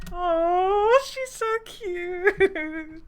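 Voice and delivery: high, drawn-out squeal of adoration; gushing coo. Real, not acted. Reader melting over a cute character, gushing coo. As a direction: coo it high, loud and very slow.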